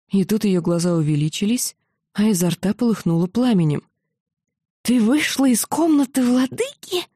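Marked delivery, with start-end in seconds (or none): 4.8-7.0 rising to a squeal, high; nearly shrieking the question. A narrator's reading. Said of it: "практически сорвалась на визг".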